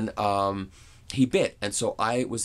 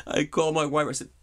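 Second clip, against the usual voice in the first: normal talking voice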